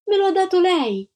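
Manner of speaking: put-on high, loud indignant child; shouting protest. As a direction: insist it out loud. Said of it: "gridò Antonella".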